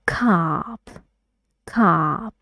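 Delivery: ordinary delivery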